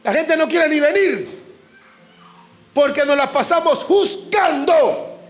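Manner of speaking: preacher stepping up the intensity; preaching with rising force. Real, not acted. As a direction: press the question on the congregation. Preacher raising voice at congregation.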